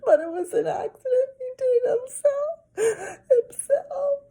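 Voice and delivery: very high, loud wailing; crying girl. A narrator's reading. Girl wailing that the toddler hurt himself. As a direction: sob out the excuse. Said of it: "Sheila cried louder".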